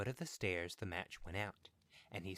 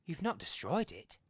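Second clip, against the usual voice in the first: urgent; 10 semitones higher, 6 dB louder than usual. Acted. Wife urgently questions husband.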